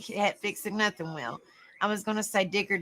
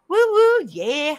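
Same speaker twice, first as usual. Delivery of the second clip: high, loud "woo woo"; playful high-pitched cheer; party whoop; 6 semitones higher, 6 dB louder, slower than usual. Pretend. Cheering an imagined party rocket.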